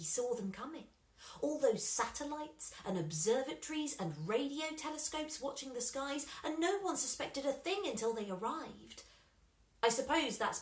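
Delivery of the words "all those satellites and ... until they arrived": usual speaking voice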